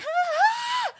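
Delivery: high, loud imitated shriek; mock terrified scream. Sister acting out being chased, high scream.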